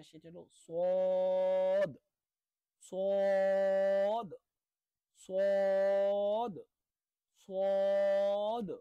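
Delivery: সদ loud, heavy and full; Arabic letter sad. Shown as a demonstration. Teacher drilling সদ loudly for class. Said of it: "পরবর্তী হরফ যেটা".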